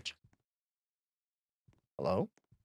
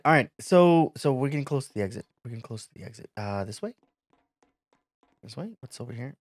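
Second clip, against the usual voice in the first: ordinary delivery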